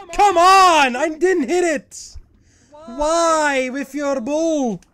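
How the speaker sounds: loud, very high protest; outraged yelling. Real, not acted. Gamer disputing a death to the game, outraged yelling. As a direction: wail in protest, stretching the words.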